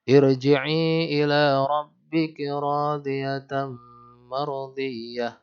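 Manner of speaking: slow chanted tilawah, phrases fading at the end; Quran recitation. Shown as a demonstration. Teacher reading Al-Fajr aloud to the class, slow chanted recitation. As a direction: recite the verse with melodic lengthening.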